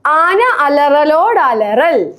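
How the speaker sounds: slow, drawn-out tongue twister; slow demonstration. Shown as a demonstration. Challenging a friend with a hard Malayalam phrase, slowly. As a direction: stretch each word out for a learner.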